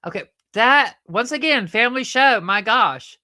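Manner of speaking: high, loud comic exasperation; exasperated scolding. Acted. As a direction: scold the puppet for crossing the line. Host scolds puppet over improper remark.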